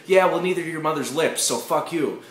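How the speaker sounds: defiant comeback to a food rule; defiant retort. Acted. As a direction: fire back at the rule.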